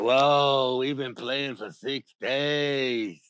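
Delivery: low, very slow, drawn-out whoa; awed realization. Acted. Animals realize how long they played, low slow awe. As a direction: stretch it out low in amazement.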